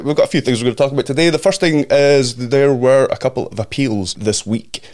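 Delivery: ordinary talk, nothing marked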